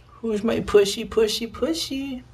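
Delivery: high, slow cooing pet voice. Real, not acted. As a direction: coo at the kitty, stretched out slow. Baby talk to the kitty.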